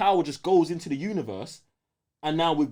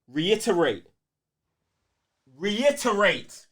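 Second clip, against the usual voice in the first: slower and higher each time, reiterate; reiterate, slower and higher with every repeat; drawn-out reiterate; 5 semitones higher, much slower than usual. Retold or imitated. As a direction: stretch reiterate out more each time, rising. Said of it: "she says".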